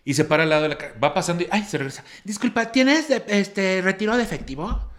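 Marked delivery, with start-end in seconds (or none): 2.2-4.9 high, prissy put-on voice of the pushy customer; mocking woman's voice. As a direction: mimic the rude lady, high and fussy. Imitating the rude woman at Oxxo. (voz chillona imitando a la señora)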